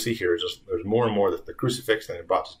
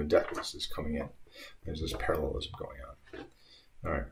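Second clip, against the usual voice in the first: usual speaking voice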